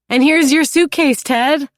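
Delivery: high, loud sudden blurt. A narrator's reading. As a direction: blurt it out eagerly. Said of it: "Louisa blurts out".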